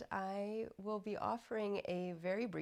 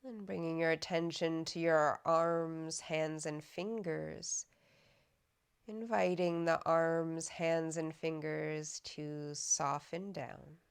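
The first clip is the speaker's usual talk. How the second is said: guided meditation; 4 dB louder than usual. Acted. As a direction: guide them unhurriedly into softening, evenly and calmly. Leads a body scan meditation.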